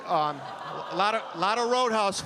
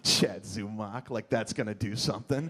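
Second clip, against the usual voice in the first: put-on drunk; 10 semitones lower, 3 dB quieter than usual. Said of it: "I'm so drunk".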